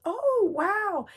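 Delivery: high, wowed exclamation; imitating admiration. Guest looking round the house, high admiring wonder.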